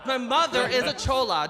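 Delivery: normal talking voice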